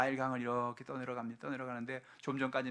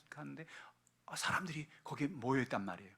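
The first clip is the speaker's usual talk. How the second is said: usual speaking voice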